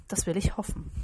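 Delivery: ordinary delivery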